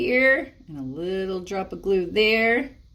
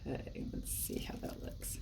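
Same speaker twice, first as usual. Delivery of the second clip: whisper; 16 dB quieter than usual. Real, not acted. Craft aside.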